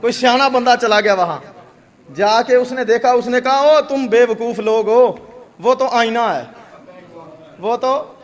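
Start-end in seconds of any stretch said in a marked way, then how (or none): 3.6-8.1 wise man scolding. Acted. Wise man scolds the quarrelling family.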